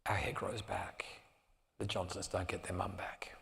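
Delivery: put-on soft whispered words of the girls. Daughters explaining why they don't regret it, whispered.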